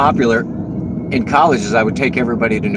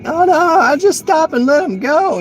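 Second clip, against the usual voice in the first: high, simpering imitation of timid drivers; mock timid voice; 4 dB louder than usual. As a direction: mimic a meek driver, pitched way up. Mocking timid locals.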